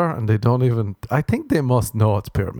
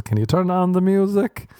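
put-on polite old man; 9 semitones higher than usual. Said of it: "tells his neighbours".

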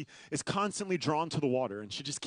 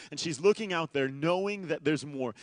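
ordinary talk

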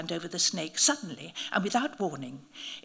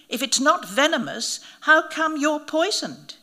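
higher, louder jeer; mocking question; 7 semitones higher, 7 dB louder than usual. A narrator's reading. Narrator jeering at the bitten priest, mocking. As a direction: rub it in, stress not.

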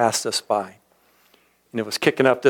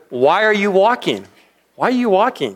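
higher-pitched imitation of the old cowboy; puzzled cowboy, pitched up; 7 semitones higher than usual. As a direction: mimic the baffled old rancher, voice raised.

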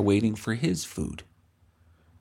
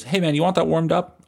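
chummy barista; 7 semitones higher than usual. Retold or imitated. Imitating barista.

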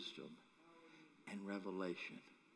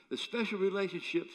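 normal talking voice

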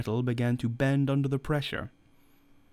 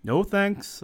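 sudden high, louder refusal; quick raised exclamation; cried out; 9 semitones higher, 4 dB louder than usual. A narrator's reading. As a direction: blurt the no out, pitched up. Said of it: "I cried".

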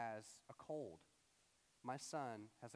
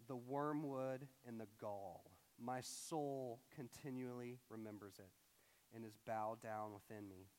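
unhurried reading from Lamentations; scripture reading; 3 semitones lower than usual. Acted. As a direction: read the verse solemnly, letting phrases settle. Reads scripture aloud.

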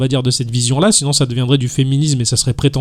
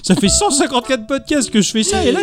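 indignant rant; 8 semitones higher than usual. Retold or imitated. Mimics indignant self.

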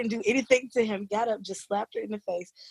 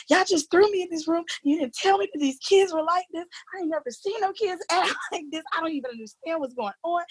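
high, upset, tearful-sounding outburst retold; retold meltdown; 10 semitones higher than usual. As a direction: replay the desperate rant to the principal, pitched up. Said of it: "I'm having this meltdown".

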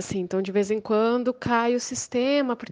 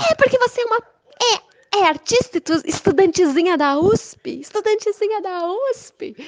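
mock teary sneer; 9 semitones higher than usual. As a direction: sneer through fake tears. Neighbor sneering through mock tears.

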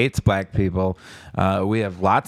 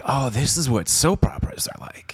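breathy whispered aside; mock whisper; 4 semitones higher than usual. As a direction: whisper it like a secret. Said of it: "It was like".

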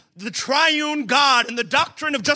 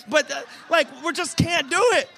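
normal talking voice

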